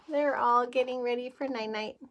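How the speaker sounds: usual speaking voice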